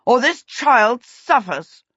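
high, slow, loud villain's threat; threatening prince. A narrator's reading. Prince threatening the child he holds, slow loud threat. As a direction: draw out the menace loudly.

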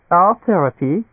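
high, amused German doctor; tittering amusement. A narrator's reading. Doctor answers a nervous patient, amused. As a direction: lift it high in mild amusement. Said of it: "Doctor Denkmal tittered with amusement".

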